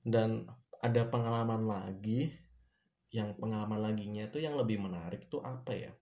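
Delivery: normal talking voice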